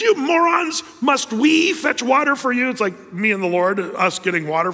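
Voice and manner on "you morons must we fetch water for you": high, loud angry scolding; furious high rebuke; ticked-off Moses. Acted. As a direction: lash out at the ungrateful crowd. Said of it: "Moses is ticked off".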